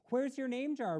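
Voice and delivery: high loud worried "Where's"; alarmed question. A narrator's reading. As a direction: ask in sudden alarm. Ralph alarmed.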